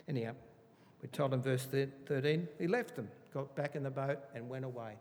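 ordinary talk, nothing marked

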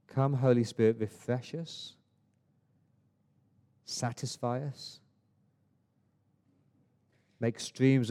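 hushed, earnest pastoral prayer. Real, not acted. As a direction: pray gently over the congregation. Leads a closing prayer.

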